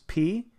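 a short crisp p; the p sound. Shown as a demonstration. Teacher demonstrating the p consonant sound. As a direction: pop out a clean p. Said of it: "the symbol for p is".

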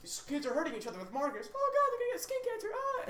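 high, frantic shrieking teacher; panicked teacher. Retold or imitated. Teacher panicking over kids hurting each other with markers, high frantic shriek. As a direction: squeal it like a hysterical teacher. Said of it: "the teacher was like".

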